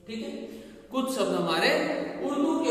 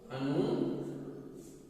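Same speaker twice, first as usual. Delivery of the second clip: अनुस्वार whispered slowly, much softer; whispered repeat; 5 semitones lower, 9 dB quieter, much slower than usual. Shown as a demonstration. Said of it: "इसको बोलते हैं अनुस्वार". (अनुस्वार धीरे से फुसफुसाकर)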